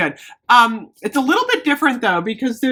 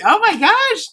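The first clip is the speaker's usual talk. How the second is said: excited gasp; 12 semitones higher, 3 dB louder than usual. Real, not acted. Excited over late-night stream.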